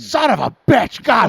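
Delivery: high, loud mock yell of pain; agonized cursing. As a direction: yell the curse as if just hit. Acts out swearing in pain.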